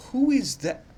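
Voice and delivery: a sudden high baffled squeak; squeaky baffled question. Real, not acted. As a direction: squeak it out in confusion. Baffled by an unknown name.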